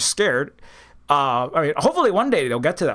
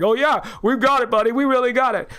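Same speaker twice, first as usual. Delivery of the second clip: higher, smug mock voice of a gloating fan; smug put-on voice, pitched up; 6 semitones higher than usual. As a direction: sneer it like a cocky supporter. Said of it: "will be like".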